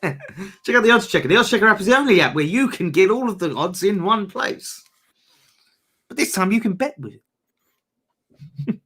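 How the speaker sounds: high, laughing mock ad read; hammed-up sales pitch, laughing; advert voice. Mock reading of a betting ad.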